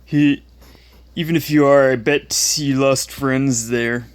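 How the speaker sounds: taunting schoolyard bully voice; mocking bully. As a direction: jeer like an online troll. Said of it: "the ones that I, I quoted".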